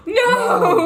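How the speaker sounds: high, loud, drawn-out "no"; gasp, then wail in dismay; startled cry. Real, not acted. Filming when the light suddenly goes out, startled cry.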